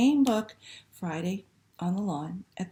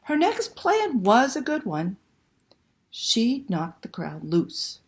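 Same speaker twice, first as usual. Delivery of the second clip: slow, lively picture book reading; reading to children; slower than usual. Acted. Librarian reading Una to children online, expressive storytelling. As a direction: read it like a bedtime story to little ones.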